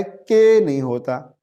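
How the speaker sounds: usual speaking voice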